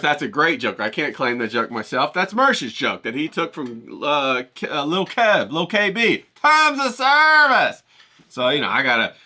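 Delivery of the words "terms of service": high, slow, drawn-out "Terms of service"; mock panic over YouTube rules, stretched out; imitating [name]. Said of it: "hear him say that".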